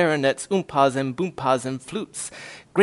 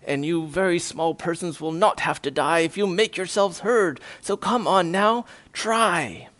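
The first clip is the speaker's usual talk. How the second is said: plain reading voice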